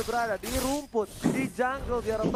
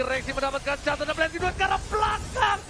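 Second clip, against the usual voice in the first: excited esports caster; 6 semitones higher than usual. Real, not acted. Game-winning call.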